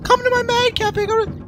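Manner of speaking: very high squeaky imitation of cafe girls; exaggerated high-pitched sales pitch; hassling street promoters. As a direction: mimic pushy girls touting on the street. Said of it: "they hassle you and they tell you".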